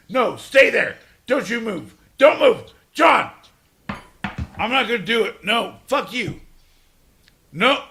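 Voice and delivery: loud, high pleading orders; yelling at friend not to leave. Real, not acted. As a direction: shout him down. Yelling at John.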